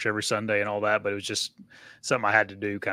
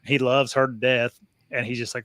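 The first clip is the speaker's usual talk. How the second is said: ordinary talk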